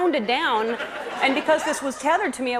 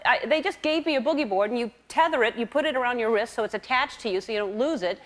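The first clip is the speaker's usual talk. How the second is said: ordinary talk, nothing marked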